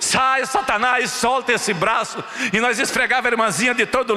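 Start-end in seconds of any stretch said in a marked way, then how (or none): 0.0-2.2 slow, commanding rebuke of the devil; rebuking the devil. Retold or imitated. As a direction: drag out each word to cast the demon out. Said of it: "usei o nome de Jesus". (repreensão lenta e firme ao diabo)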